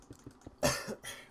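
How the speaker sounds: sharp cough into the mic. Real, not acted. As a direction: cough close to the microphone. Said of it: "Apologise for coughing onto the microphone".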